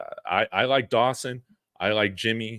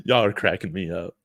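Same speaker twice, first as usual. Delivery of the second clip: ordinary talk, nothing marked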